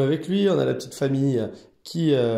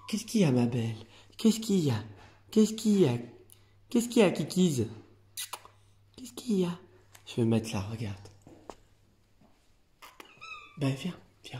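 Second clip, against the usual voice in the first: talking to a cat; 5 dB quieter than usual. Real, not acted. Coaxing a shy cat.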